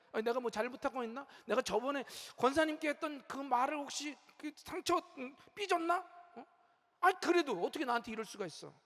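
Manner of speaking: rapid, anxious muttering to oneself; fretful inner monologue. Retold or imitated. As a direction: rattle off the worried thoughts quickly. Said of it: "막 이러면서 막".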